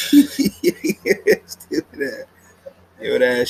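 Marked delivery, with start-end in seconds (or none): 0.1-2.3 high squeaky laugh through the words; cracking up mid-insult, high and slow. Real, not acted.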